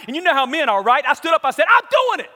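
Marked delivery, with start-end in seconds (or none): 1.7-2.3 very high, loud shout; charged-up, high battle cry; fired-up declaration. Retold or imitated. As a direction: yell it like rising to a dare. Acts out rising to the dare.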